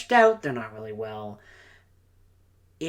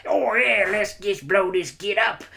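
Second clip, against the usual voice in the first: higher, hammed-up orc battle cheer; rowdy Cockney orc; 5 semitones higher, slower than usual. Orc eager to blow things up, rowdy mock Cockney cheer. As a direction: play an orc itching for a scrap. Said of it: "the orcs' perspective, where, like".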